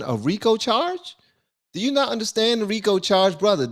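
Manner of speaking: high-pitched disbelief, challenging the listener; real incredulity, pitched well up; incredulous questioning. Real, not acted. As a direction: question it like it's absurd. Scoffing at the RICO charge.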